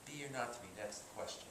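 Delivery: quiet, inward Hamlet; soliloquy murmured softly to no one; soliloquy to himself. Shown as a demonstration. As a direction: speak it low to yourself, as if alone. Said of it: "Now, what about a soliloquy? I'm Hamlet".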